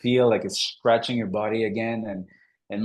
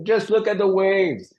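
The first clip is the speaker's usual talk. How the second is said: insistent instructor; 9 semitones higher, 4 dB louder than usual. Retold or imitated. Instructor repeating his advice.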